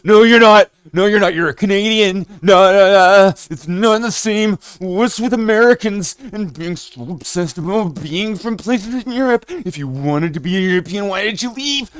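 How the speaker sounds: mocking furious UK commenter yelling at a wannabe European; mock outraged rant. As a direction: play an offended Brit scolding the Canadian. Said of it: "typing like Bruce Almighty".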